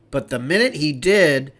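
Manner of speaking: ordinary delivery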